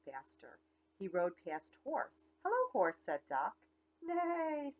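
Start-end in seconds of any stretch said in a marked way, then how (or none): 2.4-3.0 high, bright little duck greeting; cheery duck. Acted. Duck greets the cow from his bike, high chirpy hello. 4.0-4.7 high playful neigh; whinny it for the kids; mock horse neigh. Horse answers the duck, playful high neigh.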